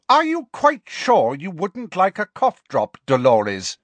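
slow, high, pointed enquiry; exasperated schoolmistress politeness. Acted. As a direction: offer it with strained patience. McGonagall exasperated by the coughing.

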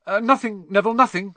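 high, loud, flustered cover-up; hurried denial. A narrator's reading. Harry hiding the cloak from Neville, hurried denial.

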